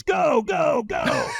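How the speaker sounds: high-pitched mock shout; retold screamed command. Trainer sends a firewalker onto the coals, imitated shout. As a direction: imitate a trainer yelling someone into action. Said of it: "So he screamed at me".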